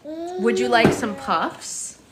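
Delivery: high, slow sing-song offer. Real, not acted. Mother offers her baby snacks in a high, slow sing-song.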